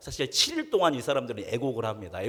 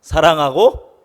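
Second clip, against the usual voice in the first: loud, ringing greeting; loud blessing call; 9 dB louder than usual. Real, not acted. Pastor leads the church greeting, loud. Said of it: "서로 뭐라고 축복합니까".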